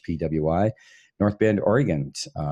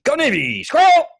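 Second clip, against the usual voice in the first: excited shout; 6 dB louder than usual. Pretend. Reading out chat shouts.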